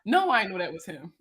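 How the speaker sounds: ordinary talk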